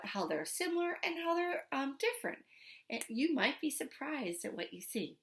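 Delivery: plain reading voice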